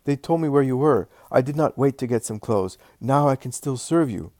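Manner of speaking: put-on overjoyed old slave. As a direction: tell the master of the miracle, breathless with joy. Cured old slave tells his master.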